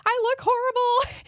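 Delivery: high-pitched mock wail of horror; Juliet's mom. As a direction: wail it high, hammed-up movie panic. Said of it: "running around the house like".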